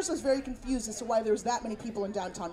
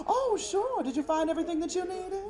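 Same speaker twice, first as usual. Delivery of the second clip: high, sweet hospitality voice; eager-to-please Southern lilt; Southern concierge; 7 semitones higher than usual. Retold or imitated. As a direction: chirp it like a smiling Southern hotel clerk. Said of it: "with a genuine Southern smile".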